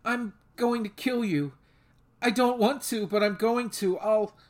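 frightened apology. A narrator's reading. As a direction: voice her rising fear as she apologizes. Sveta warning in despair.

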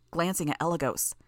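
ordinary delivery

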